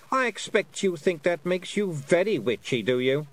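high-pitched queenly condescension; mocking Queen. A narrator's reading. Queen belittling the young witch, high mocking voice. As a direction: talk down to her as a queen. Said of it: "said the Queen".

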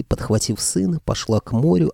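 ordinary delivery

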